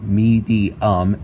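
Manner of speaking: "me, the, um" as three separate beats of medium; split into syllables. Shown as a demonstration. Tutorial host counting syllables, "me, the, um" in three distinct beats. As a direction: sound out "me, the, um" slowly, one syllable at a time. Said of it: "is three syllables".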